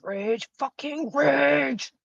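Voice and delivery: slow, drawn-out mock raging; mock rage. Muttering at the game, slow drawn-out mock rage. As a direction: rage in a stretched-out, put-on voice.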